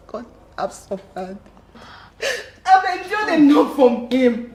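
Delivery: crying through the words; tearful. Acted. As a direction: sob out that it is enough. Wife telling of abuse.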